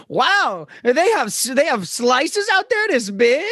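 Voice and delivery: high, drawn-out wonder; exaggerated high-pitched awe, slow at the end; mock amazement. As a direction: marvel in halting learner English. Acting an amazed learner misusing phrase.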